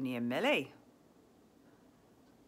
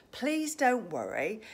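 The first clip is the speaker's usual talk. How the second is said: ordinary talk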